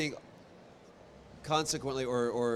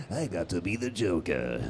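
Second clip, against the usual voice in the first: awed; 5 semitones lower than usual. Real, not acted. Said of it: "I don't pinch myself and will go".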